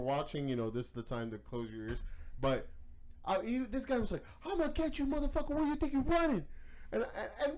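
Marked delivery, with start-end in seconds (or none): 4.4-6.4 raised, high mock shouting threat; imitating an angry chaser, pitched up; mock thug threat. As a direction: bark it like a street tough chasing someone. Man chasing bike guy threatening.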